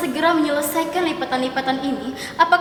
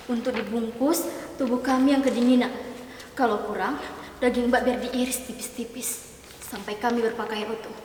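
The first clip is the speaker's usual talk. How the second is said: creepy hushed telling; 4 semitones lower, 8 dB quieter than usual. Acted. Spooky tale told to tease.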